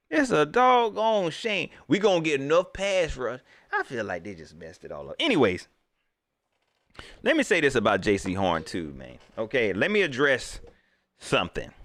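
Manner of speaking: ordinary talk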